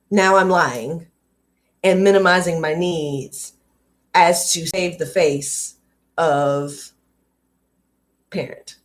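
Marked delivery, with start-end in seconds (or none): none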